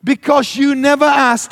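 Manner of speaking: repeating the friend's line in outrage; mocking repeat. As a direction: throw his words back at him. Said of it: "to which I said".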